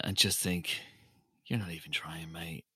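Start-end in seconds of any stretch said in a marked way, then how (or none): 1.5-2.6 whispered aside. Retold or imitated. Mocking a lazy Santa.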